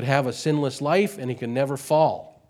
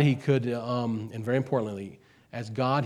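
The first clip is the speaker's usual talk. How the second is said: ordinary talk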